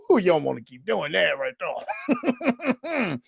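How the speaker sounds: put-on old man chuckle. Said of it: "That's when you know you're old, when you do that laugh".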